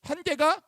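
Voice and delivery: 한계가 said high and loud; emphatic answer. Real, not acted. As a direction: hammer 한계가 home. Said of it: "바울은 뭐가 있대요". (한계가를 높고 크게 강조)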